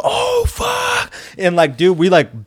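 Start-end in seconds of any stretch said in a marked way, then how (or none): 0.0-1.1 high panicked yell; panicked shout. Retold or imitated. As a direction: shout it in sudden terror. Car teetering, everyone yelling.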